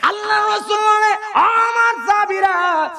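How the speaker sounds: high, wailing cry to the companions; wailing preacher's call; crying shout. Acted. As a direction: cry it out over the crowd.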